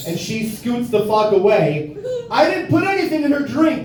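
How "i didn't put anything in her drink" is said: mild mock protest, pitched high; indignant protest. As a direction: protest your innocence in a higher voice. Protests innocence to the audience.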